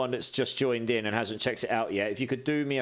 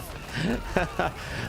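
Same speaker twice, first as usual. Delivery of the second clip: high laugh; 8 semitones higher than usual. Real, not acted. Laughs about nothing to achieve.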